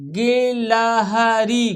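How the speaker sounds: गिलहरी découpé en syllabes longues et égales; détache et allonge chaque syllabe de गिलहरी; lecture syllabée. Shown as a demonstration. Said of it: "हमारे साथ पढ़ना है आपको".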